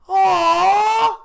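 imitated very loud, high "aww"; loud cooing squeal. Imitating a girl cooing at Pokemon, full volume. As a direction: blast out a gushing coo, far too loud. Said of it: "at that volume".

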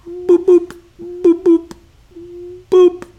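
high electronic beeping; metal detector beeps. Acted. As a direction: imitate a detector with high, even boops.

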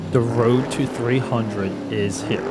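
big podcast intro read; announcer intro. Acted. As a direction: announce it like a show opener. Show intro over music.

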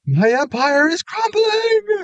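very high, slow, stretched-out wail; hammed-up despairing villain; mock villain wail.

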